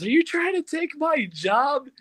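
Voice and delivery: a hint of playful indignation; imitating Matthew Lillard. Retelling what Lillard said to him in line, mock-voiced. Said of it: "he looks at me and says".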